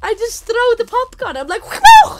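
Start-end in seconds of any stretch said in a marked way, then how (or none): 1.6-2.2 high, loud laughing whoa; startled whoa. Retold or imitated. Spilling popcorn on the old woman, startled laughing yelp.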